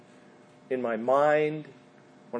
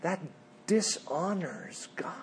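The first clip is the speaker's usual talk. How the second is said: hushed whisper; 11 dB quieter than usual. Real, not acted. Preacher to congregation, hushed whisper. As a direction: whisper it, soft and grave. Preacher stresses God's dishonor.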